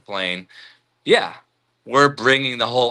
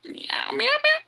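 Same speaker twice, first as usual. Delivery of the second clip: mock meow; 5 dB quieter, slower than usual. Meowing at the cat.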